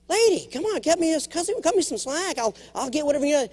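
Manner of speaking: high, whiny plea for slack; mock pleading with a strict teacher. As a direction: beg her to cut you some slack.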